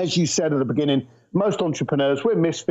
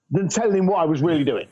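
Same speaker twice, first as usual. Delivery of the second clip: laughing through the words, quicker; faster than usual. Real, not acted. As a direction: giggle as it spills out. Laughs at own story.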